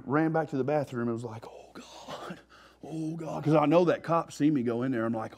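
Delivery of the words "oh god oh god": hushed, scared breathy "oh God"; panicked whisper. Retold or imitated. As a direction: whisper it like you're hiding from cops. Panicked hiding in the bathroom.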